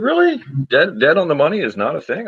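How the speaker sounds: mock shock that anyone doubts the phrase; incredulous. Retelling his reply to someone who said dead on the money is not a thing, incredulous. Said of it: "I said".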